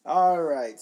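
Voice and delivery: a loud, punchy "All right" to open the segment; loud host opener. Real, not acted. Host opening the guest segment to listeners, loud call.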